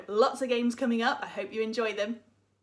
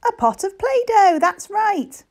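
high loud excited reveal; 10 semitones higher, 10 dB louder than usual. Acted. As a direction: announce it with delight. Revealing answer to child.

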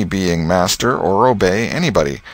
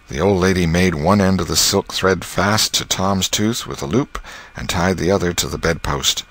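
ordinary delivery

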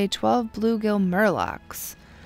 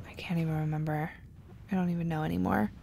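whisper; 3 semitones lower, 8 dB quieter, much faster than usual. Real, not acted. Muttering to self.

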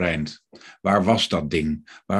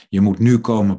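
louder, pressing hard on "nu"; urgent plea; 2 semitones higher, 7 dB louder than usual. A narrator's reading. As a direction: insist on "nu", voice raised in appeal. Frightened victim urgently calls for help.

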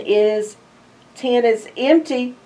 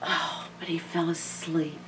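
soft hushed aside; whisper; 4 semitones lower, 15 dB quieter than usual. Real, not acted. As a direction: hush so he sleeps. Reading, child asleep.

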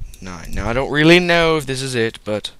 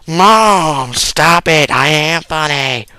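louder, higher protest; whiny kid; 4 semitones higher, 10 dB louder, slower than usual. Retold or imitated. Son protesting to his mom, loud and whiny. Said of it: "And I was like".

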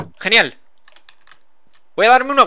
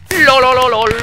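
gloating lol; 7 semitones higher, 7 dB louder, slower than usual. Real, not acted. Gloating after the win.

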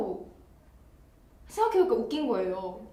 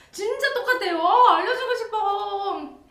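much higher and louder, laughing; excited squeal; 10 semitones higher, 8 dB louder than usual. Real, not acted. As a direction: gush it out high with laughter. Excited about stylist's speech.

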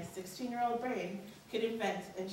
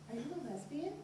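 asked softer, so nobody overhears; lowered voice; 6 semitones higher, 6 dB quieter, faster than usual. Retold or imitated. Mom asking her daughter quietly in a restaurant. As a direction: hush it for the restaurant. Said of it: "lowering her voice so the other patrons".

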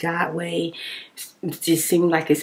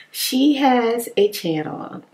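ordinary talk

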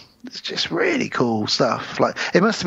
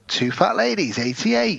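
bingo caller; 6 semitones higher than usual. Retold or imitated. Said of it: "they would go".

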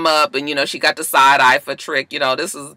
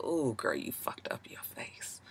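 unvoiced catty whisper behind a hand; 2 semitones lower, 21 dB quieter than usual. Whispering a snide remark about Nikki's face. Mocking a surgery result.

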